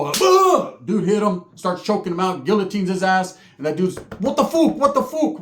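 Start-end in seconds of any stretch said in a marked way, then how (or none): 0.2-0.8 high, loud punched grunt; pained grunt. Retold or imitated. Cousin getting hit, high loud pained grunt. 4.2-5.4 high, loud panicked protest; panicked choking cry. Retold or imitated. Dude being choked out, high panicked protest.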